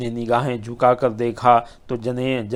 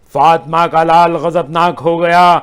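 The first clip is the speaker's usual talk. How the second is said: higher and louder, full-force oratory; impassioned majlis climax, higher and louder; rising preaching climax; 4 semitones higher, 7 dB louder than usual. Acted. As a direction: thunder it out as the martyr's wrath rises.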